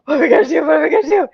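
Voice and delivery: high, hurried anxious questioning; retold breathless panic. Fleeing mother asks companion for a plan, breathless. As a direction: imitate panting panic after a run. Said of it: "I'm, like, out of breath".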